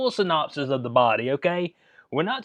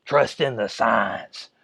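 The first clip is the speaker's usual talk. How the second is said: lower, slow, sneering mock voice; mocking the science crowd; 6 semitones lower, slower than usual. Vlogger mocking people who say trust the science, in a slow sneering voice. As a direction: drag it out like a smug preacher. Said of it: "these are the same people that tell you about the science".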